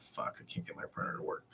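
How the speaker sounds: unvoiced whispered aside; whispered frustration. Quoting his own printer frustration in a whisper. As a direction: whisper it to yourself, fed up. Inner thought muttered.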